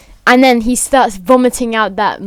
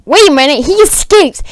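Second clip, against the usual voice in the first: high, loud sudden alarm; mock alarm; 6 semitones higher, 4 dB louder than usual. Guard discovers the prisoner escapes, alarmed call. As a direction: call it out as if just noticing. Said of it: "this guy's like".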